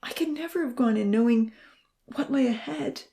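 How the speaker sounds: high, halting, anguished testimony; strained, emotional and pitched high; choked out. A narrator's reading. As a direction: push the words out through tears. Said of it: "he choked out".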